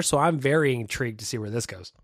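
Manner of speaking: usual speaking voice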